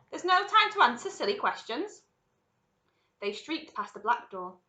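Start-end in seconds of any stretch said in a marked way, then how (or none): 0.1-2.0 high, loud brush-off; impatient shout. A narrator's reading. Wonka waves away Mike's silly question, shouting impatiently. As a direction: dismiss the question at full voice. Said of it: "shouted Mister Willy Wonka".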